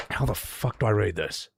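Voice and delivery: ordinary talk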